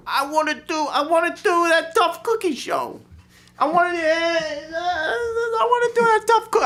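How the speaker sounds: high, whiny Jersey crooner voice. Retold or imitated. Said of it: "my good pal [name] gave me a call and said".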